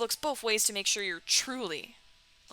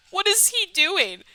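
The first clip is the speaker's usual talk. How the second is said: normal talking voice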